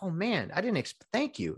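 pleasantly surprised. Retold or imitated. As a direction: sound caught off guard by a gift. Receiving an unexpected gift.

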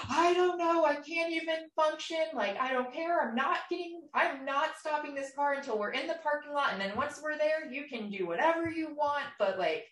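words pushed out high through tears; crying while talking. Retold or imitated. Driving while he threatens to jump out, high tearful plea. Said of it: "I just started, like, crying".

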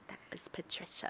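breathy unvoiced creepy whisper; Split movie character. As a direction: whisper it like the split-personality villain. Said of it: "he's like".

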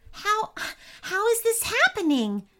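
put-on very high, amazed boy; delighted. A narrator's reading. Boy watches the unicorns grow wings, delighted. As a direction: stumble on the first word in happy wonder. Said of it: "asked the boy with a delighted grin".

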